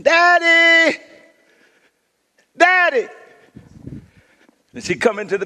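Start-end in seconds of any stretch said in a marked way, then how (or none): 0.0-3.1 put-on high, loud child's call for her dad; daughter calling out. Little daughter hunting for her hidden father, calling out high and loud. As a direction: call out like a little girl seeking Daddy. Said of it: "she'd come into the closet looking".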